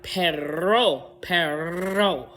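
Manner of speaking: Spanish-flavoured Perro, slow and whispered; whispered Spanish-accent Perro. Shown as a demonstration. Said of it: "They have more of that French or Española".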